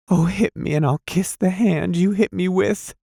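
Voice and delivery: high, breathless begging; woman begs him to hit her; imploring. A narrator's reading. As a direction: abject desperate plea. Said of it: "she implored him, vividly, stupidly".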